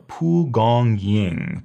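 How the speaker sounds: Pu Gong Ying said slowly, syllable by syllable; slow pronunciation. Shown as a demonstration. Herb teacher drilling students on the name Pu Gong Ying, slowly. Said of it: "After that is Pu Gong Ying".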